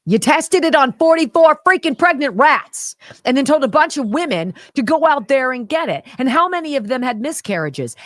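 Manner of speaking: loud, high furious rant; angry rant. Real, not acted. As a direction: let the outrage pour out. Heated rant.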